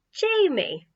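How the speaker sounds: high, falling cheeky name-drop. A narrator's reading. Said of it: "Slightly reproving of her cheekiness".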